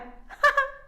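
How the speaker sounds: short high laugh; burst of laughter. Real, not acted. Laughs at the author's photo.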